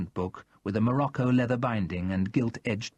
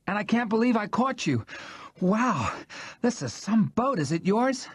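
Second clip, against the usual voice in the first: much higher, eager woman's voice; thrilled to see the boat; gushing wonder; excited Jackie; 10 semitones higher than usual. Acted.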